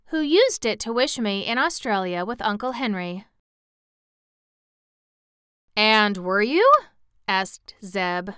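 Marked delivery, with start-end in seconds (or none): 5.7-6.7 mildly astonished question, louder. A narrator's reading. Boy astonished at what he heard, astonished. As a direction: ask it in mild astonishment, louder at a rising end. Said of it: "astonished at what he heard".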